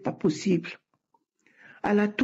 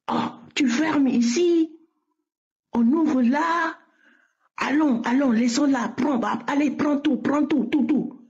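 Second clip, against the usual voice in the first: strange voice speaking; 5 semitones higher than usual. Retold or imitated. Retelling the voice speaking in her mother tongue, higher. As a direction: let the other voice speak through her, pitched up. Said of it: "la voix commençait à parler en ma langue maternelle".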